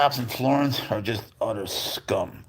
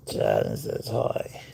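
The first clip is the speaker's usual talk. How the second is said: much lower, slow voicing of Shad; 13 semitones lower, much slower than usual. Owner voices Shad greeting viewers low and slow. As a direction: drop the voice and drawl it. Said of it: "repeated lower".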